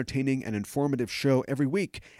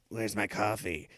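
low, soft, slow catchphrase impression; mock captain asking slowly; Janeway; 5 semitones lower, 6 dB quieter than usual. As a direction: draw it out low and quiet. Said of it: "or Janeway's".

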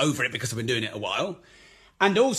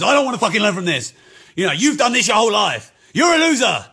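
higher, much louder furious rant; evil inner voice; 6 semitones higher, 10 dB louder, faster than usual. Acted. Inner critic shouting him down, much louder and furious. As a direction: snap back like a bitter bully. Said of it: "evil bastard".

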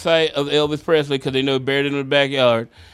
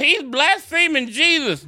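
high, slow, scandalized fan voice; mock outraged yell; 10 semitones higher than usual. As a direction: quote the shocked fan crying out. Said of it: "Somebody yelled out".

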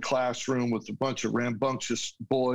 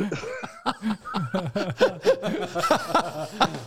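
real laugh; 9 semitones higher, 4 dB louder than usual. Real, not acted. Speaker laughs at dad's joke.

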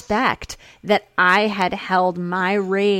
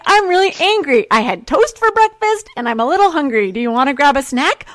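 high, loud, breezy mock rage; cheery angry chatter; 7 semitones higher, 5 dB louder, faster than usual. Telling askers she's angry, high and breezy. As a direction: list anger like breakfast news. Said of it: "And I'm like".

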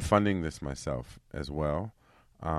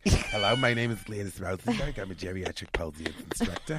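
Gladys Rosenberg; 2 semitones higher, 6 dB louder than usual. Acted.

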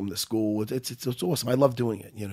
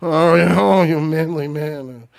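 mocking fucko. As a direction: ham up the wannabe cop. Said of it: "Fucko comes over".

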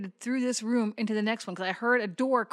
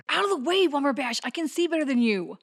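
pushy; 5 semitones higher, 4 dB louder than usual. Acted. Shoving past a companion.

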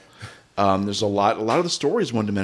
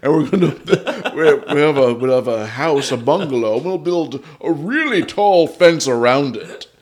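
mustache twirling villain; 4 semitones higher, 5 dB louder than usual. Retold or imitated. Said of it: "They were just talking like".

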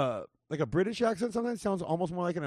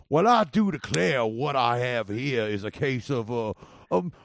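Southern trial lawyer; 3 dB louder than usual. Acted. Man playing a trial lawyer, Southern accent. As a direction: declaim in a Southern accent, building louder. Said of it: "a trial lawyer in the South".

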